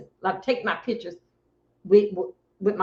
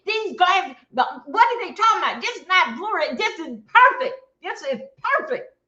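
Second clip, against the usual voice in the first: much higher, insisting it's fine; retold insistence; 9 semitones higher than usual. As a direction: protest high that it's perfect. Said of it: "I kept on saying".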